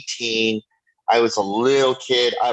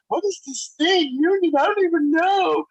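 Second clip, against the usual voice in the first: very high squeaky retelling of own confusion; high-pitched mock bewilderment. Mimics own clueless younger self.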